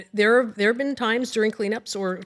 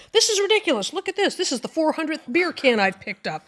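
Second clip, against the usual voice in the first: much higher, louder fed-up complaint; exasperated volunteer; 7 semitones higher, 3 dB louder than usual. Retold or imitated. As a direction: vent like a volunteer sick of trash. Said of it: "they also have that".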